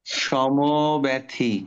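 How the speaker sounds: সমব্যথী drawn out very slowly, sho-mo-bet-thi; slow model word. Shown as a demonstration. Teacher slowly models সমব্যথী for the class. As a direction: lay each syllable of সমব্যথী down. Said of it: "পড়ো, পড়ো।".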